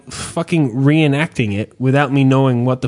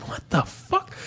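stunned; 9 dB quieter than usual. Retold or imitated. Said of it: "I'm like".

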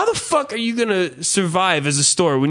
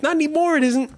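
mock retort; 12 semitones higher, faster than usual. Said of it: "I'm like".

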